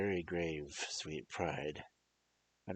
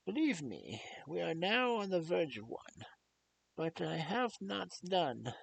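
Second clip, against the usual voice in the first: put-on grave old doctor; 14 semitones higher than usual. Old doctor solemnly asks leave to behead Lucy. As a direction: lay out the mystery with weight. Van Helsing explains, then asks.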